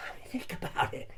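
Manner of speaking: an unvoiced conspiratorial whisper; whispered aside. Real, not acted. Comic host whispering the point to his audience. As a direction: breathe it like a secret.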